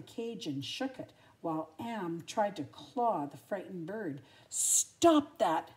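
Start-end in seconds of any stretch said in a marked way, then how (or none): 4.5-5.7 loud sharp command; barked. Acted. Lady stopping the cats, loud bark. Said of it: "barked Lady".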